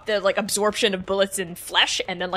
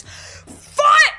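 scream; 4 dB louder than usual. Acted. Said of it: "scream at the top of their lungs".

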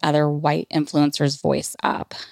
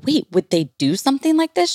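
higher, startled question; alarmed; 8 semitones higher than usual. Retold or imitated. Asking the nanny in alarm, higher startled question. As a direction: ask it in sudden alarm.

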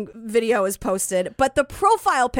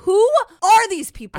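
incredulous outburst; 6 semitones higher, 5 dB louder than usual. Retold or imitated. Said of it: "I'm just like".

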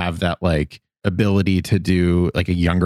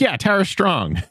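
dismissive mock answer; 12 semitones higher than usual. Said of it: "It's kind of a cop out".

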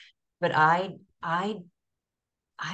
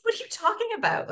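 much higher, fast, disbelieving; incredulous; faster than usual. Retold or imitated. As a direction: fire it back in total disbelief. Retold own disbelief at brother.

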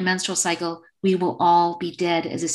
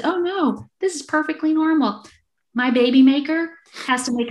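put-on much higher, gentle reassurance to a small child; calm a scared little girl, pitched way up; soothing mom voice; 7 semitones higher than usual. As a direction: mom soothing her daughter in a high sweet voice. Mom soothing alarmed young daughter.

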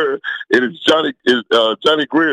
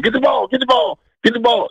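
high-pitched yell at normal volume; imitating himself yelling on the field; retold yelling; 10 semitones higher than usual. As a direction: call out urgently, pitched way up. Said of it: "and I'm yelling".

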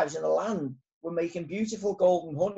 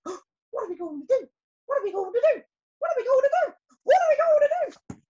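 rising higher and louder with each panicked repeat; panicked shouting; 6 dB louder, faster than usual. Acted. Young bees panicking, building from high fright to a loud shrieking panic. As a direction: squeal in growing panic. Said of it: "began crying and shouting uncontrollably".

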